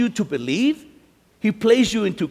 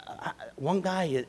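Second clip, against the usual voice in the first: normal talking voice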